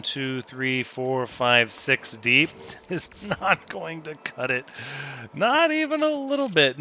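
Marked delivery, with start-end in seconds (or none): 2.8-6.8 high-pitched laughing dismissal; amused dismissal. Real, not acted. Player rejecting too small a layout, laughing dismissal. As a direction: laugh as you rule it out.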